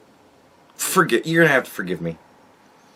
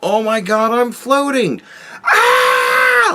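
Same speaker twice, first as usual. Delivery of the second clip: mock giddy scream; 11 semitones higher, slower than usual. Mocking the women in space, rising from a high gush to a scream. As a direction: ham up a giddy floater who ends in a squeal. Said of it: "Why is it always the screaming?".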